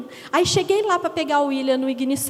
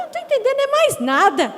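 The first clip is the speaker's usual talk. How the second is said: high, loud, baffled outburst; exasperated; 9 semitones higher, 6 dB louder than usual. Retold or imitated. To the churchwoman, exasperated and loudly baffled. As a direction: throw up your hands, totally lost. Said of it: "Eu falei".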